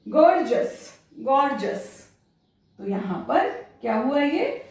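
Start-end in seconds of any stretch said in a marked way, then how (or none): 0.1-2.1 गॉर्जियस said as gorgeous, ending in jus. Shown as a demonstration. Teacher modelling gorgeous for students. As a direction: land गॉर्जियस on a short jus. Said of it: "जस पढ़ना है".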